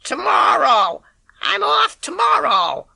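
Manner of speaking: put-on high, slow old-lady call; in her voice. Boy calling to the milkman in the old lady's voice. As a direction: put on the old woman's voice to fool him. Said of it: "I called out, in her voice, in her accent".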